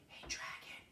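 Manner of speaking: very soft whisper, Hey, dragon breathed without voice; whispered very softly. Acted. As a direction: whisper as if checking whether he sleeps. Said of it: "Elizabeth whispered very softly".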